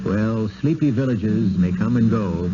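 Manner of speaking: ordinary delivery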